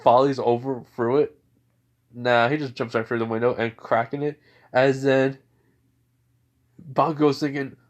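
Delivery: ordinary delivery, nothing marked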